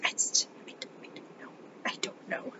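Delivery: breathless whisper, at a loss; whispered disbelief. Real, not acted. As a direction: whisper it, lost for words. Speechless at boys.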